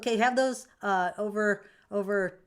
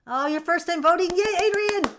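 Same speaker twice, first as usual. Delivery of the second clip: much higher, louder, delighted; excited cheer; 12 semitones higher, 11 dB louder than usual. Real, not acted. To a chatter voting for the first time, thrilled cheer. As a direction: burst out cheering for them.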